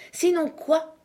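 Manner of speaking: high-pitched acted retort; defiant challenge. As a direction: dare him to finish the threat. Uncle challenges an angry threat.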